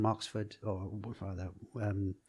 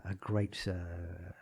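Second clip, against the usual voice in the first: normal talking voice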